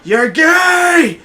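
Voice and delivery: high, loud group shout; screamed taunt. Retold or imitated. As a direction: yell it across the playground. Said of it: "We all screamed".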